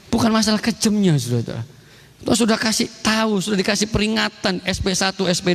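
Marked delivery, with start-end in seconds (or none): none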